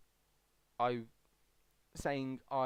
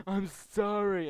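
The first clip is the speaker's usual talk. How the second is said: mock apology; 7 semitones higher, 9 dB louder, slower than usual. Child apologising to trolling parents.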